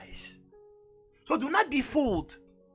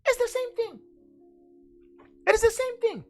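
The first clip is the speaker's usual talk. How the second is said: high-pitched exclamation; 14 semitones higher than usual. Real, not acted. Preacher exclaims over ancient symbols.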